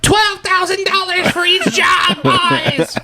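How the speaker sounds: hammed-up ringside shout as Pelosi; mock Nancy Pelosi yelling to the crowd; imitating Pelosi. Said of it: "being like".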